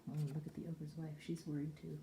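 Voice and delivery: usual speaking voice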